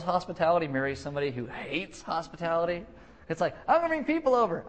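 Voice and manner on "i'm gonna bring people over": high, loud, gushing announcement; hammed-up delight; mock eager host. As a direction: announce it like an over-keen hostess. Acts hospitable spouse.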